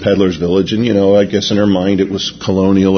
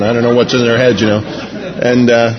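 usual speaking voice

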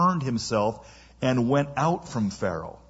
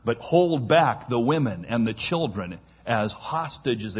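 ordinary delivery

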